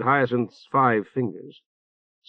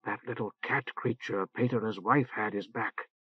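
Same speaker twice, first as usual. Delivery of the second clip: much softer, secretive whisper; 11 dB quieter than usual. A narrator's reading. Matar to Orc, warning him in a whisper. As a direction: lean in and whisper it. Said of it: "she whispered".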